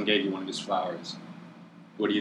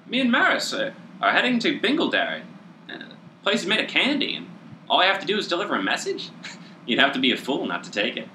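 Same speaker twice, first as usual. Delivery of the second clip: squeaky little gnome, much higher; chirpy gnome, pleased with himself; 9 semitones higher, faster than usual. Acted. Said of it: "just says".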